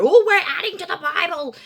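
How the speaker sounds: high, loud put-on outcry; mock alarm. Mimicking objectors to an added word, mock alarm. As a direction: cry it out as an exaggerated objection. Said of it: "That doesn't go".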